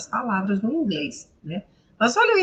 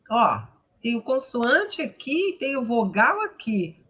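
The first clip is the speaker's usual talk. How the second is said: ordinary delivery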